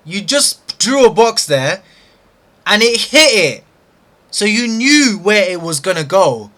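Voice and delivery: loud, high, angry berating; furious scolding. Real, not acted. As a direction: rage at your own mistake. Trader scolding himself over missed trade.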